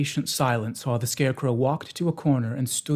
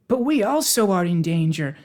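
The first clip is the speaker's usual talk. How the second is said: high, louder, anxious interjection; 6 semitones higher, 5 dB louder than usual. A narrator's reading. Pumpkinhead warning that they share the danger, anxious. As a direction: pipe up high with worry. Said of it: "said the Pumpkinhead anxiously".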